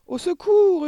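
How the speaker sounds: very high, loud wail; screamed for help. A narrator's reading. Mother terrified by a ghost, screaming for help. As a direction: scream out in panic. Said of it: "hurla la mère".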